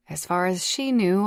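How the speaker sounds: ordinary delivery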